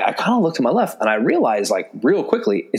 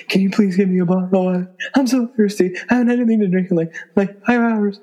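desperate plea; 8 semitones higher, faster than usual. Retold or imitated. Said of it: "she's, like, trying to whisper to me".

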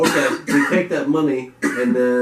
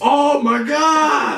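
high, loud, drawn-out gasp of fake amazement; mock shock; 13 semitones higher, 5 dB louder, much slower than usual. Co-host hearing barbie means barbecue, mock amazed shout. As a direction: stretch it out like the news is astonishing.

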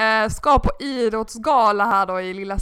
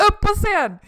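high, loud call; raised urgent call; calling on stage; 10 semitones higher, 6 dB louder than usual. Retold or imitated. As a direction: order them up to the stage. Organisers summon her on stage.

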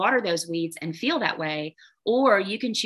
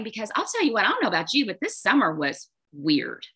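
normal talking voice

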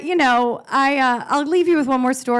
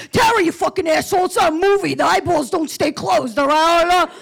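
angry brother; 5 semitones higher than usual. Retold or imitated. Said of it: "short fuse ... all pissed off".

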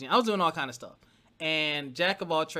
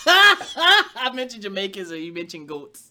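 laughing; 7 semitones higher, 13 dB louder, slower than usual. Real, not acted. Laughing at goat joke.

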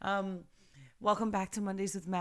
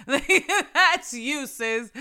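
high, loud, through laughter; real laugh breaking into the words; laughing tease; 6 semitones higher, 8 dB louder, much slower than usual. Real, not acted. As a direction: tease the listener while cracking up. Laughs while teasing listener.

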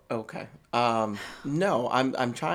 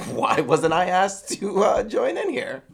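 loud, hammed-up walking-in yell; mock barging in; 4 semitones higher, 11 dB louder than usual. Walking in on his cohost, loud mock outrage. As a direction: burst in shouting like you caught them. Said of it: "I'd walk in like".

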